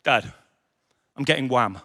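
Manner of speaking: put-on low, soft, proud kid voice; lightly voiced boy, quiet and serious; little son bragging. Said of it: "he said".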